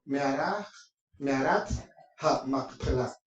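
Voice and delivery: "Mearat ha Macpela" in Hebrew, stressed on the final a; Hebrew pronunciation. Shown as a demonstration. Preacher showing how it is said in Hebrew, "Mearat ha Macpela" with final stress. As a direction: demonstrate "Mearat ha Macpela" word by word, stress landing on the last syllable. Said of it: "Así se dice en hebreo".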